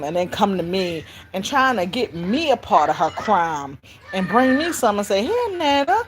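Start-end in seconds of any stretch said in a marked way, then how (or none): 5.2-6.0 put-on high little-kid voice, lifting at the end; child offering. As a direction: offer it like an innocent small child. Child offers stolen candy.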